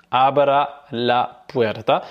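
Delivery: slow teacher repetition; slow repeat. Shown as a demonstration. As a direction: repeat it slowly so they can copy. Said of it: "donc tu vas dire".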